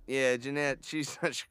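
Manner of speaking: ordinary talk, nothing marked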